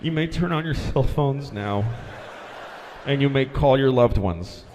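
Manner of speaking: low, solemn pilot announcement; shaken pilot. Retold or imitated. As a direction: say it like someone who nearly died. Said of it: "He said that".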